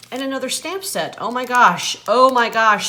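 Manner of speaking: high, loud squeal of delight; thrilled squeal. Real, not acted. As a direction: shriek it out over a treasured gift. Squealing over new stamps.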